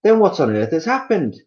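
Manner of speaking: alarmed wife crying out to her husband; Missus Twit crying out. Acted. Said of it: "cried Missus Twit".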